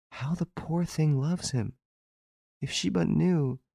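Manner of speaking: high, soft, pitying murmur; deeply touched. A narrator's reading. Hortense watching the poor girl from hiding, deeply touched. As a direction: say it softly and high, moved. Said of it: "Hortense, deeply touched, could not help saying".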